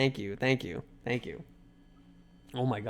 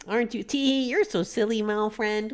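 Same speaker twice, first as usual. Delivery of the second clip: put-on much higher, playful cute-girl voice; girly voice; 6 semitones higher than usual. Voicing the winking girl.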